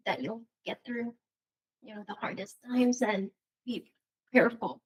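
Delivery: tearful, halting, with frequent pauses; choked up. Real, not acted. Wife hopes their children learn from it, choked up. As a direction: push on through real tears. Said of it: "I'm getting choked up".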